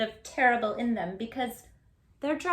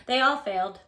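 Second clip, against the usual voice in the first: plain reading voice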